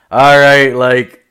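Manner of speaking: sudden loud flustered burst; loud startled reply. Retold or imitated. Comic answering the angry girl, loud flustered burst. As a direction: blurt it out caught off guard.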